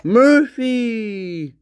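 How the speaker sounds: high, long, sing-out dog call; calling for attention. Retold or imitated. Imitating someone trying to get the dog's attention, calling out. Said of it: "Trying to get his attention".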